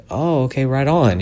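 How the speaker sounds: high-pitched, caught-off-guard agreement; awkward reaction. Retold or imitated.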